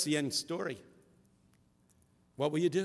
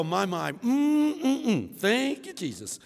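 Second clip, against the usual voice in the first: put-on old folks' praise; 8 semitones higher, 5 dB louder, much slower than usual. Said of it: "They go".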